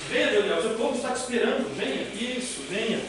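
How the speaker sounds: high, soft coaxing call; coaxing a pet. Real, not acted. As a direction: coax the dog to come. Chamando o cachorro. (voz carinhosa chamando o cachorro)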